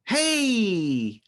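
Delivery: high, drawn-out excited call; exclamation-point greeting. Shown as a demonstration. Teacher demonstrating a greeting for students, excited and drawn out. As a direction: stretch it out with a few exclamation points' worth of pep. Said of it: "how many exclamation points should I use when I say it".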